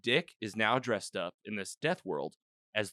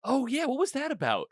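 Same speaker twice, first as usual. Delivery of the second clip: high-pitched, eager question; real burst of curiosity, pitched high; sudden excited curiosity; 14 semitones higher, 4 dB louder than usual. Real, not acted. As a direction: perk right up at the reminder. Suddenly reminded of a puzzling scene.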